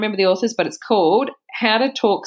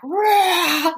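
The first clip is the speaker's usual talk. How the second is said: mock angry roar; 13 semitones higher than usual. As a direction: roar like a furious little girl. Daughter's furious reaction retold.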